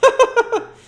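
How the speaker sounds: loud, high scornful laugh; mocking laugh. A narrator's reading. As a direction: laugh in his face. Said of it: "responded the girl with a laugh".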